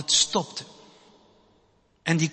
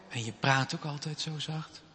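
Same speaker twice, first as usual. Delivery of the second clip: ordinary talk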